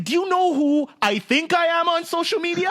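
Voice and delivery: hammed-up entitled fury; indignant outrage. Passenger snapping at the flight attendant, high mock indignation. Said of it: "He's like".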